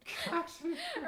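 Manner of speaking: high laughing echo. Real, not acted. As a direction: laugh the words out. Echoing partner's joke, laughing.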